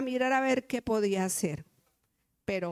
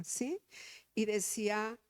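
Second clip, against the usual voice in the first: normal talking voice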